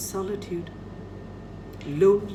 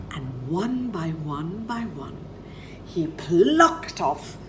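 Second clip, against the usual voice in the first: usual speaking voice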